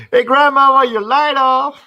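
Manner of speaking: high, loud Texas holler; Texas accent. Acted. Calling to grandmama, loud high Texas accent. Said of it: "Yep, that's Texas".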